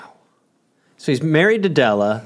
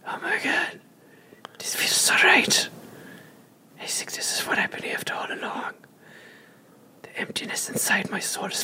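hushed rapturous whisper; ecstatic whisper; 8 semitones higher, 9 dB quieter than usual. Pretend.

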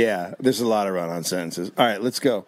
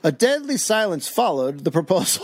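dramatic mock read; 7 semitones higher than usual. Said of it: "dramatic reading, cry-shout".

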